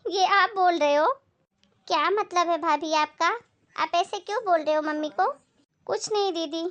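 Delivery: ordinary talk, nothing marked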